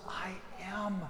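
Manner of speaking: soft, slowing, falling to near hush; tender. Acted. Jesus to Thomas and the others, tender and soft. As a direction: offer it gently like open arms. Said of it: "but open arms".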